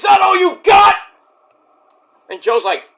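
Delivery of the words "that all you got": high, loud taunting yell; defiant scream. Retold or imitated. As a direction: challenge him at full volume. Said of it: "screaming at Joe".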